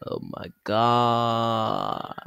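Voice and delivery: very slow, stretched last word; drawn-out exclamation. Real, not acted. Vlogger stalls before signing off, drawn-out exclamation. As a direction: hold the final vowel long and level.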